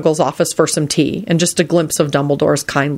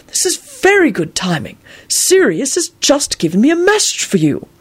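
put-on old wizard voice; 10 semitones higher than usual. A narrator's reading. Dumbledore voice.

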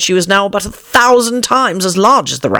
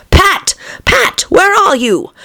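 high, shrill angry calls; angry shouting; slower than usual. A narrator's reading. The Rabbit shouting for Pat, high and angry. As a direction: bellow for the servant. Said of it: "Next came an angry voice, the rabbit's".

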